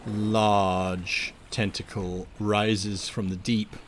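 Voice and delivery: drawn-out, low storytelling; slow dramatic narration. A narrator's reading. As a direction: stretch each large slowly.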